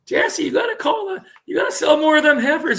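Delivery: high, loud mock scolding; exaggerated high-pitched telling-off; imitating a nagging complaint. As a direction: pitch it way up like someone nagging you. Retells Nikki nagging him about heifers.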